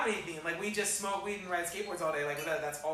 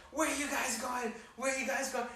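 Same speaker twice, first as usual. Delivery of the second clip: high mock yell without the volume; imitated screaming; 6 semitones higher than usual. Drunk woman calling after the fleeing kids, mock screaming. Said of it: "she's screaming. She's like".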